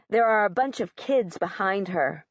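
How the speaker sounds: ordinary delivery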